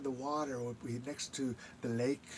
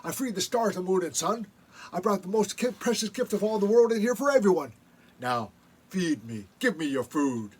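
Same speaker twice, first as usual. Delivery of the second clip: upset Raven; 5 semitones higher, 7 dB louder than usual. Acted. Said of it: "says Raven".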